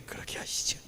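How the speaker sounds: meek whisper. Retold or imitated. Son meekly agreeing.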